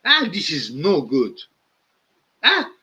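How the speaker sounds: loud, high, crying shout in broken English; angry arguing. Retold or imitated. Pastor ranting down the phone at a radio host, loud and upset. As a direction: sputter and shout like he's near tears. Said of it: "starts arguing with the guy, saying".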